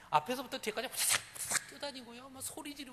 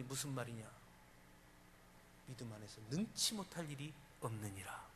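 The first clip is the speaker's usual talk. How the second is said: ordinary talk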